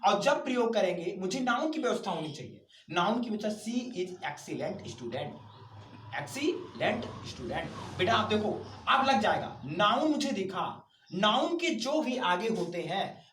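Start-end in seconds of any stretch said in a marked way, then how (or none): 3.6-8.0 slow dictation. Shown as a demonstration. Teacher dictating example sentence.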